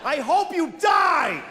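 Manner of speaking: high, slow, screamed curse; furious road-rage shout. Retold or imitated. Screaming at a driver who cut in, furious shout. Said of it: "I said that to a person".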